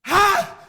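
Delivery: sudden very loud high yell of "Ha!", a short burst; retold battle cry. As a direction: shout "Ha!" like you're leaping off. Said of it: "he's propped up there and he goes".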